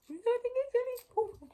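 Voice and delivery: high fast squeak for the penguin; squeaky penguin voice. Pretend. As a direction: answer for the toy penguin. Voicing penguin's reply to reader.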